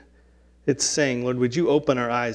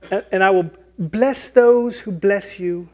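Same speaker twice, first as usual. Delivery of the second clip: higher, lightly voiced fairy; fairy blessing; 4 semitones higher than usual. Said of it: "the blessing of, like, a fairy".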